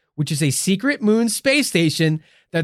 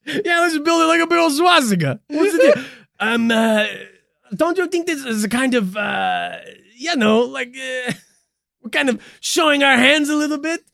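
hammed-up, higher fake German officer voice; lightly put-on German accent, played for laughs; mock German accent; 8 semitones higher than usual. A space Nazi jokes about hiding the base, in a mock German accent. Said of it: "That's not a German accent".

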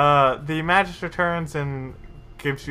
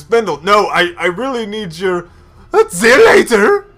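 loud, rising call much higher; calling after; 7 semitones higher, 11 dB louder than usual. Acted. The Justicar calling after departing Findle, loud and high. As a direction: shout after him as he walks out.

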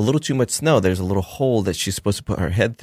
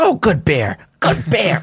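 high, positive praise for an animal; imitated trainer's encouraging coo; gushing praise; 8 semitones higher, 4 dB louder, slower than usual. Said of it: "the whole thing was so positive".

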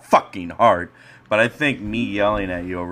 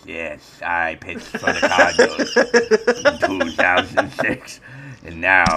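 Brad Thompson voice; 3 semitones lower than usual. Acted. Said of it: "my Brad Thompson voice".

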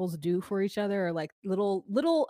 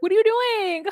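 much higher and louder, stunned disbelief; shocked high squeal at the proposal; shocked shriek; 6 dB louder than usual. Retold or imitated. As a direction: squeal it like you just caught him kneeling. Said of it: "and she's like".

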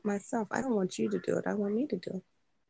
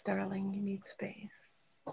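slow, breathy seductive whisper; 11 dB quieter, slower than usual. Real, not acted. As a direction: hush it, slow and intimate. Flirty whisper to a partner.

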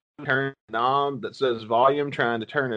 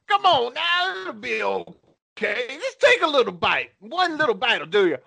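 much higher sly coaxing voice; Bill Cosby impression; 10 semitones higher than usual. Host as creepy comic coaxing a victim, high and sly. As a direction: lure them in sweetly. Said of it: "my Bill Cosby impression".

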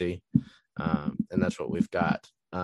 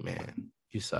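quiet disappointed taunt; 3 semitones lower, 8 dB quieter than usual. Retold or imitated. Disappointed trash talk in a game.

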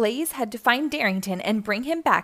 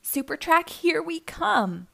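mild shout; 7 semitones higher than usual. Acted. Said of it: "shouted Darrington".

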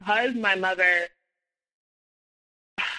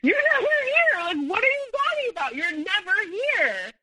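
mad outburst; 13 semitones higher than usual. Retold or imitated. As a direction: blow up in high-pitched, incredulous protest. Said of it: "I'm getting mad 'cause I'm like".

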